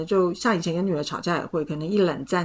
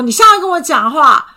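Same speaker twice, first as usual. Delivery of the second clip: higher, louder insistent demand; reenacting nagging her boyfriend, higher and louder; 8 semitones higher, 9 dB louder than usual. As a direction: demand that he talk to you now. Nagging boyfriend in retold fight.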